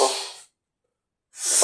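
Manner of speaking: long, soft, voiceless "uff" exhale; breath out. Shown as a demonstration. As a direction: slowly blow all the air out. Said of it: "ఉఫ్ అనండి". (నెమ్మదిగా ఊపిరి వదులుతూ ఉఫ్)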